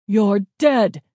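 loud, menacing shout; gruff yell. A narrator's reading. Gunman firing near hidden children, menacing yell. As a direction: yell it out as a threat. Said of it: "he yelled again".

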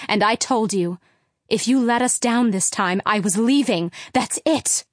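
loud, furious mother, pitch climbing; mother yelling. Acted. Mother yelling at husband about broken promises. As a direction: shout the broken promises at him. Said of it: "Mom started yelling again".

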